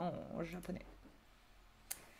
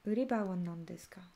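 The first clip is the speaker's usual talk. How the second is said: chuchoté; 3 semitones lower, 5 dB quieter than usual. Acted. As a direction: question posée sans voix. Question chuchotée aux élèves. (question mystérieuse chuchotée)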